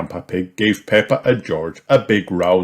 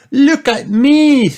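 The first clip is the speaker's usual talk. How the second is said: put-on high, loud, slow proud girl; Peppa voice; 8 dB louder, slower than usual. A narrator's reading. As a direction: show off proudly. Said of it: "said Peppa".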